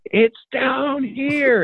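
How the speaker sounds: high, slow, drawn-out call from far away; distant yell. Retold or imitated. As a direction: call it out long across the mountain. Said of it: "all of a sudden I hear".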